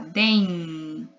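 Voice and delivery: dem with the closing nasal held long; drawn-out final n. Shown as a demonstration. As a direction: let the end of dem hum on. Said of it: "Tá no sinal".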